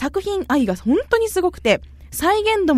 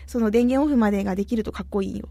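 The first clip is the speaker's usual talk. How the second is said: ordinary talk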